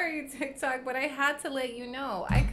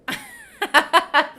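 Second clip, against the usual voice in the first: loud laugh; 12 semitones higher, 16 dB louder than usual. Real, not acted. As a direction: burst of loud high laughter. Laughing at the translated name.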